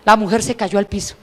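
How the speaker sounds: usual speaking voice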